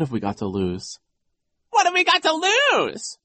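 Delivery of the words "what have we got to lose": very high, loud falsetto parroting; Nero mocking. A narrator's reading. Vice principal parrots the boy's question, mocking. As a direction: mimic it in a squealing jeer. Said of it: "Nero mocked".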